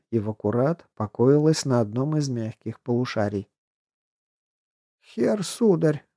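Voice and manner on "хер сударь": put-on high, slow wheezing girl after near drowning; hoarse wheeze. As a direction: gasp it out hoarsely, half drowned. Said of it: "прохрипела Марта".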